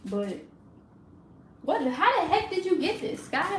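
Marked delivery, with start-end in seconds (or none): 1.6-3.6 high, swooping pitch of disbelief; exasperated scolding. Real, not acted. Owner asking Sky how she got this, exasperated scolding. Scolding dog over found item.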